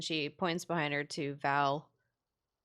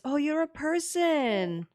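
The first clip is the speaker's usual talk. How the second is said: loud, high-pitched gushing wonder; delighted amazement; 10 semitones higher, 6 dB louder than usual. Acted. Tiefling rushing over to the wolf turned person, delighted amazement. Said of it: "gasps and smiles and runs over".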